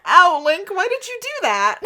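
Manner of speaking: high, wounded whine; mock-pained, high-pitched complaint; hurt protest. A narrator's reading. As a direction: cry out as if stabbed. Stabbed girl cries out.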